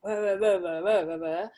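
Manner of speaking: loud mock old-time London babble; a loud nonsense impression of an old London accent; grandad's London accent as loud, playful babble; old London accent mimicry. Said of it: "had a really strong London accent of that era".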